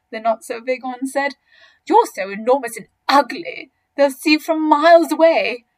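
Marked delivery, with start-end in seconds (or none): 1.8-5.7 loud, scornful, rising higher; not so big crocodile, insulting. Acted. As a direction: taunt the big croc.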